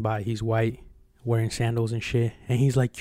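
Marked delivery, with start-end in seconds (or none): none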